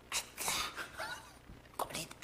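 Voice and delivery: whispered goal celebration; suppressed cheer. Retold or imitated. Father cheering a goal as children sleep, suppressed cheer. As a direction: cheer under your breath, re-enacting.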